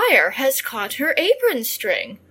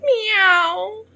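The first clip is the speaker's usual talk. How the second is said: high, mournful crying meows; crying cats; 10 semitones higher, much slower than usual. A narrator's reading. Cats mourning by the ashes, high crying meows. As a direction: cry sadly as the cats. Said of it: "how they cried".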